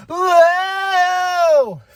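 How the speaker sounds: high, loud cheering whoa; excited whoa. Real, not acted. Fan cheering a wrestler's mic drop with a loud whoa.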